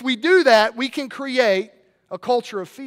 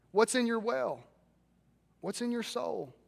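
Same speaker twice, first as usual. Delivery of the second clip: quiet probing question; 12 dB quieter than usual. Real, not acted.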